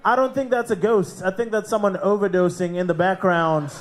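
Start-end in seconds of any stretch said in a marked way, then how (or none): none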